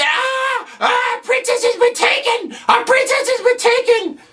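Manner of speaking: very high squealed falsetto wail; panicked little kid. Acted. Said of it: "these little kids".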